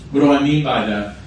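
ordinary delivery

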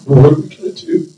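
acted fretting, floor-pacing worrier; hammed-up worried questioning; mock worry. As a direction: play someone anxiously pacing. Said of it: "he's not worried".

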